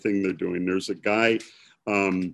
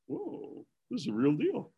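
awed whisper; 11 semitones higher, 10 dB quieter than usual. Retold or imitated. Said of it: "And I'm going".

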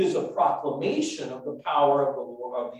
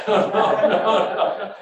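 ordinary delivery

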